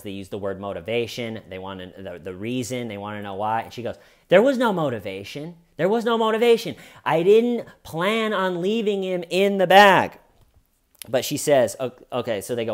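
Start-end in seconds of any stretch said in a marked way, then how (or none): 4.3-10.2 high, emphatic mock protest; imitated protesting denial. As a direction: insist on it, higher and louder, as an imitation. Suspect denies motive in interrogation.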